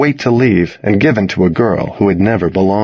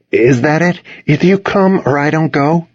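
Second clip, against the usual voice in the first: higher-pitched exasperated questioning; 7 semitones higher than usual. A narrator's reading. Boy to dog blocking his leaving, exasperated. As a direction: put it to the dog with mild exasperation. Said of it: "I asked in exasperation".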